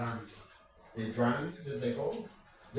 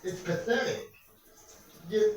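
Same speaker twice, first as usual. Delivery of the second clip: normal talking voice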